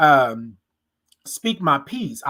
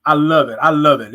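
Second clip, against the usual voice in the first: loud, delighted I love it; loving it; 7 dB louder than usual. Real, not acted. Thrilled by viewers calling her beautiful, loud delight. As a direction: gush over the compliment. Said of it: "That part right there".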